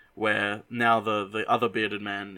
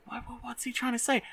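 higher and softer, puzzled asking; 13 semitones higher, 7 dB quieter than usual. Retold or imitated. Bearded man leaning closer to Santa, high puzzled asking. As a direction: wonder aloud what he's mumbling. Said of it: "and he's like".

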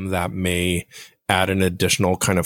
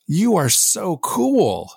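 much higher, bright and gushing "You are so cool"; genuinely excited read; 9 semitones higher, slower than usual. Retold or imitated. Friend rereading the same text as an excited, sincere compliment. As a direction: say it like you truly mean the compliment. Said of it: "you're choosing how to read that".

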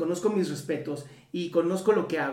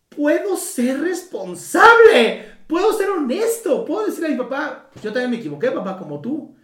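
put-on child's voice; 7 semitones higher, 7 dB louder than usual. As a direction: play the son, wide-eyed and relieved. Voz imaginada del hijo. (voz de hijo asombrado, aguda y lenta)

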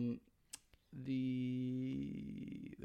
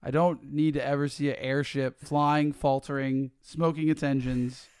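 loud, drawn-out complaint; exasperated rant; 10 dB louder, slower than usual. Real, not acted. Co-host sick of airship CGI, exasperated. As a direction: lean hard and slowly on the words. Ranting about too many airships.

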